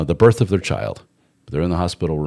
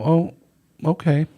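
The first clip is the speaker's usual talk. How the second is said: high, meek little oh okay; meek acceptance; 10 semitones higher than usual. Retold or imitated. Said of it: "make her go".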